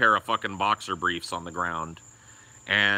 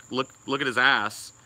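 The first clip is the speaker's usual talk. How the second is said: usual speaking voice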